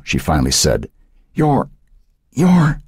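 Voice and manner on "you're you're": high, halting search for a name; faltering recognition. A narrator's reading. Old woman struggling to recognise her grandson, faltering. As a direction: grope for who he is, pausing between tries. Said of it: "Her words died on her lips".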